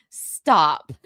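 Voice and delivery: normal talking voice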